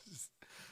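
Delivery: soft whistling to oneself; awkward whistle. Retold or imitated. Musician on stage whistling to himself, awkwardly. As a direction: imitate an awkward little whistle. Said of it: "whistling to myself".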